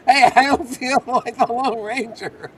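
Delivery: high, slow, laughter-filled voice; laughing. Real, not acted. Laughing at the other's failed call.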